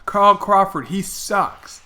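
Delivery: loud megaphone heckle, high and loud. Retold or imitated. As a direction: call it out over a megaphone. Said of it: "a thick British accent".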